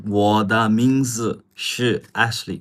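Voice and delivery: "我的名字是Ashley" slowed down, each syllable spaced out for learners; slow demo. Shown as a demonstration. Teacher repeating the sentence slowly for viewers. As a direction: stretch out "我的名字是" slowly so learners can follow. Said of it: "one more time".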